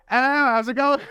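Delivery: imitating British, a little over the top; slipped-in British accent. Retelling greeting a London worker, mock British accent. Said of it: "I just kinda slipped a little bit".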